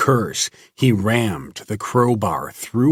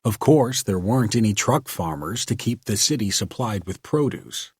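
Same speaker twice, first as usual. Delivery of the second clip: plain reading voice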